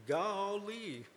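high, drawn-out Gomer Pyle "Golly". Shown as a demonstration. Teaching the congregation to say "Golly" like Gomer Pyle. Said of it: "So that's how it starts".

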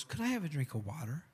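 soft, gentle request; softly asking for a drink; quiet ask. Acted. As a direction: ask for the water quietly, almost under breath. Jesus asks woman for water.

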